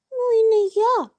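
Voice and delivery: mouse voice. A narrator's reading. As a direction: squeak out a refusal, small and high. Second mouse refuses in tale.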